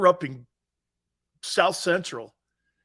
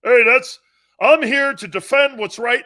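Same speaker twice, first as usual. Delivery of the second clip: higher, loud heroic yell; hammed-up righteous heckler; mock injustice fighter; 7 semitones higher, 11 dB louder than usual. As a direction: proclaim like a self-appointed hero. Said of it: "injustice fighter now".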